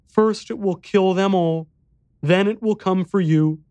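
put-on high little-boy voice; solemn, frightened boy. A narrator's reading. Boy warning the narrator of the beast, solemn child voice. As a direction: deliver the warning as a grave child.

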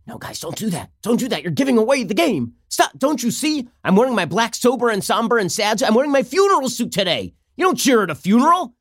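frantically shushing celebrating colleagues, hammed up; scolding Pelosi. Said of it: "has to turn to them and, and shake them off".

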